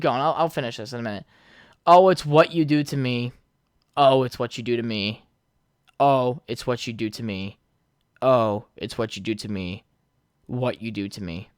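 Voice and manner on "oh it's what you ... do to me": put-on old tone voice. Shown as a demonstration. Reading Hey There Delilah lyrics aloud to his podcast audience, mock dramatic. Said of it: "in my old tone voice".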